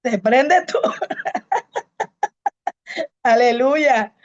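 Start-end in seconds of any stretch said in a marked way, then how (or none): 0.8-3.2 a sudden high unvoiced laugh; high breathy laugh. Real, not acted. Bursts out laughing in church. (risa aguda y sin voz)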